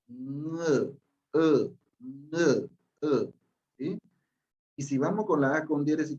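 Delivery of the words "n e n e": soft nasal "N" then "E", repeated; listen and copy the syllable; syllable demo. Shown as a demonstration. Teacher telling the class to listen to the syllable.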